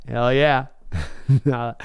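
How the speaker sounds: ordinary talk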